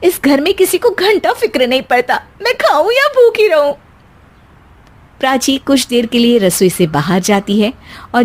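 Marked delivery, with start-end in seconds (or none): 0.0-3.7 angry grumble. Acted. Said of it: "Prachi गुस्से में".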